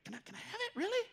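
soft, high, unsure request; mock timid helper, soft and high; timid asking. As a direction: ask shyly if it's allowed.

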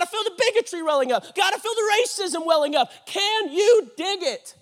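loud, high, mounting plea; impassioned prayer. Acted. Believer begs God to dig out sin, impassioned prayer. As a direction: cry out to God at full force. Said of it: "This is a prayer".

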